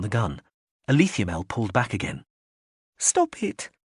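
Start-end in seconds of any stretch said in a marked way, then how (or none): 3.0-3.7 put-on small, much higher little girl's plea; tiny voice. A narrator's reading. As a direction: shrink the voice to almost nothing. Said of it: "her voice tiny".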